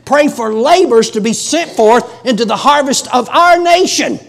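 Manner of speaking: loud, high-pitched revival exhortation; full-force preaching. Real, not acted. Preacher urging congregation to pray for the nation, forceful preaching. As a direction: drive each plea out like a revival preacher.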